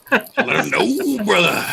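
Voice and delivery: wrestler promo voice. Retold or imitated.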